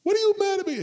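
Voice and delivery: very high, loud squeal of outrage; hammed-up indignant driver; mock outrage. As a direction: other driver turning it around. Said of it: "they wanna fight you".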